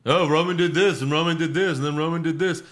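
high, loud sing-song parody of the commentators; mocking commentary. Podcaster mocking wrestling commentators, high loud parody. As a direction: ham up the monotonous play-by-play.

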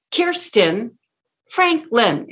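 slow "Kirsten" rising, pause, "Franklin" punched; musical pause. Shown as a demonstration. Coach modelling name as a score, pause before "Franklin". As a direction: lift "Kirsten", then land both beats of "Franklin". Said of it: "it's a score".

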